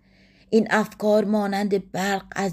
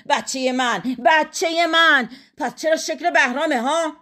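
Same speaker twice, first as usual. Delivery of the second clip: jeer, stretching the repeated phrase; mocking; 6 semitones higher than usual. A narrator's reading. Husband doubting the child is his, mocking. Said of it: "با لحن تمسخرآمیز گفت".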